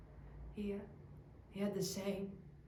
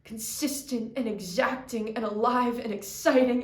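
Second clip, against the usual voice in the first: rising louder and higher; building intensity; 3 semitones higher, 11 dB louder than usual. Real, not acted. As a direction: build to a fevered pitch. Rant builds again.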